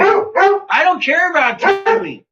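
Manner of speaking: squeaky cartoon voice of the dog; dog's voice. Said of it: "Tell Seamus about Timmy".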